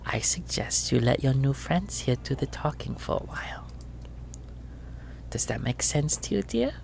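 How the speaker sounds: sweet, soft, menacing auntie voice; treacle voice. Acted. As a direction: threaten sweetly and quietly. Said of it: "Her treacle voice is back".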